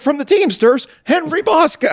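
very high, rising MC call; mock announcer. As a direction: announce the fighter's name with a flourish. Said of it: "he's like".

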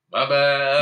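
put-on a friend joking that she'd say it to her kids; mock apology. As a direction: toss off a careless apology. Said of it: "you're like".

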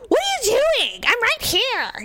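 high-pitched mock outraged girl; imitated yelling. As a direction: mimic an indignant kid yelling at a driver. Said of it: "she's, like, yelling at me".

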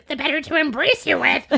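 high-pitched creepy storybook wolf; hammed-up wolf in disguise, pitched well up. Disguised wolf answering the girl, creepy high put-on voice. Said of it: "And the wolf says".

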